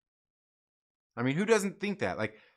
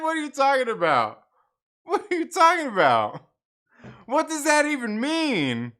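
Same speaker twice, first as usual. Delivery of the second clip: high, loud disbelief; what are you even saying; incredulous; 11 semitones higher, 7 dB louder than usual. Retold or imitated. Acted incredulous reaction.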